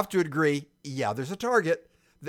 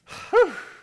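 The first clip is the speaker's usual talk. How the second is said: loud sigh, sharply higher; sighing as the debate ends; 8 dB louder than usual. Real, not acted. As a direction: let out an exasperated sigh. Ends debate over joke.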